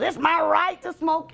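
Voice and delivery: high slow indignant whine; mock the entitled smoker; whiny smoker. Everybody goes.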